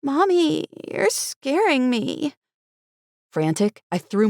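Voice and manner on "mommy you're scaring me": put-on high, slow little girl; a frightened small girl; scared child. A narrator's reading. Daughter scared of her mother, high and slow. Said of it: "Tina shrank away from me".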